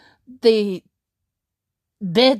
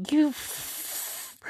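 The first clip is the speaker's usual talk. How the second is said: voiceless, softer bitten-off curse; 6 semitones higher, 10 dB quieter than usual. Retold or imitated. Nearly cursing at Jordan, voiceless and cut off. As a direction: swallow the swear before it lands. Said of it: "I was like".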